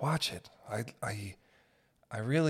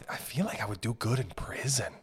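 ordinary delivery

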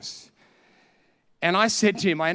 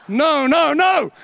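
higher, louder panicked no; mock panicked shout; 10 semitones higher, 8 dB louder, much slower than usual. Pastor dreads Sue's honest answer, mock panicked shout.

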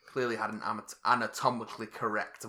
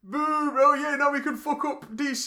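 mocking commenters; 13 semitones higher, 5 dB louder, slower than usual. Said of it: "people going".

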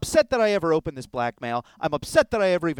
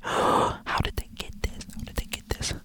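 panicked whispered aside; hushed, voiceless muttering; alarmed whisper; 4 dB quieter than usual. Acted. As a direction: whisper to yourself in disbelief. Alarmed aside while reading letter.

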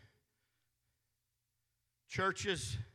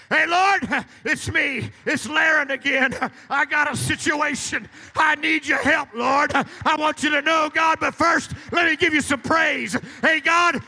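high, loud calling out to God; loud prayer demo; 7 semitones higher, 9 dB louder than usual. Shown as a demonstration. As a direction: shout so the Lord knows where you are. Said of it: "I pray with a loud voice".

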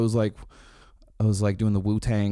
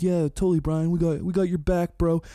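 higher, eager, bro-ish support; eager agreement; 7 semitones higher than usual. Retold or imitated. Bandmates backing Brian up, eager and higher. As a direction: chime in eagerly to back him up. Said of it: "So everyone's like".

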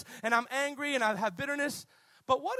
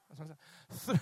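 normal talking voice

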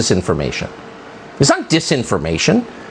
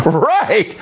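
higher, mocking agreement; sarcastic echo; 7 semitones higher than usual. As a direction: scoff it as if parroting her. Scoffs, echoing the mocked pundit.

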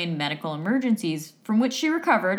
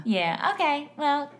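put-on agreeable server, pitched up; high waitress voice; 8 semitones higher than usual. Co-host playing waitress with customers, high put-on voice. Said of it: "in the register".

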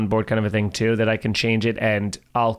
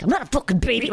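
indignant outburst; 10 semitones higher, much faster than usual. Retold or imitated. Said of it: "they're like".

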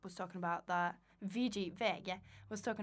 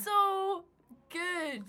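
high, loud delight; excited; 11 semitones higher, 7 dB louder than usual. Real, not acted. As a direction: squeal it with glee. Thrilled by the bark.

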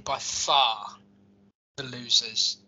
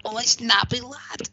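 higher, drawn-out mock Scouse accent; terrible Scouse accent; 6 semitones higher than usual. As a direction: put on a badly faked Scouse accent, pitched up and slow. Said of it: "That was a terrible Scouse accent".

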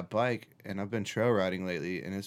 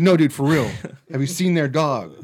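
mock urgent; 4 semitones higher, 7 dB louder than usual. Faked serious alarm.

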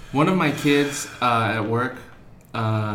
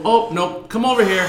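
high, louder teacher summons; teacher calling over; 8 semitones higher, 5 dB louder than usual. Retold or imitated. Teacher calling a kid over after machete talk, imitated firm high summons. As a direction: imitate a teacher firmly redirecting a small child.

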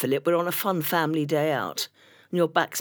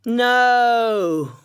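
put-on loud, higher teen shout of no; flat refusal; 7 semitones higher, 7 dB louder than usual. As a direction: bark a loud, high teenage no. Gemma refuses musical.

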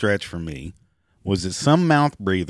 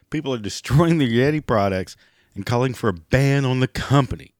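ordinary talk, nothing marked

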